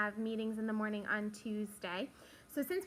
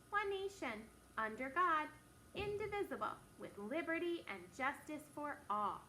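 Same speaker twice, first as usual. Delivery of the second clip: measured phrases with pauses between; pledge recitation; 2 semitones higher, 4 dB quieter than usual. Shown as a demonstration. Teacher leading the class in the pledge, measured recitation. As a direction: recite it steadily for a class to follow.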